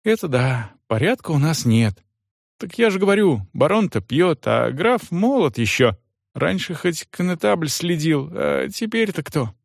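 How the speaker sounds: servant Johan. Acted.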